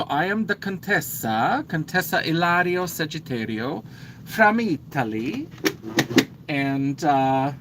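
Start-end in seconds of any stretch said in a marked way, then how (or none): 0.0-5.5 a light put-on Italian accent, a touch higher. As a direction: introduce the Contessa in a mock Italian accent. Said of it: "my Italian voice".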